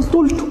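طلت said tultu, short u; damma on first letter. Shown as a demonstration. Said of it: "الضمة فتقول". (طلت بضمّ الطاء)

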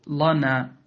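"لنا" opened on a dark, thick l; wrongly heavy lam. Shown as a demonstration. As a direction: thicken the first syllable of "لنا". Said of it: "ولا تقل". (تفخيم لام "لنا")

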